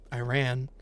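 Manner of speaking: ordinary talk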